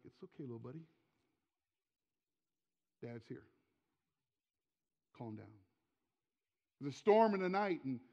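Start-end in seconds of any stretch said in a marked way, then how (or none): 0.0-5.5 very soft, low reassurance; calm a frightened child, hushed and unhurried; gentle retold fatherly comfort; soothing dad.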